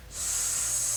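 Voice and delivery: a long hissed "S" with the tongue tip down; isolated S sound. Shown as a demonstration. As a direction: hold the S with the tip behind the bottom teeth. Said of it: "practice saying your S".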